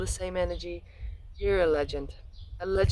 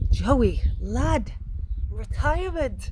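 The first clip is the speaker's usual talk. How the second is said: mock teasing; 5 semitones higher, slower than usual. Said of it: "No, I'm just playing, giving you a hard time".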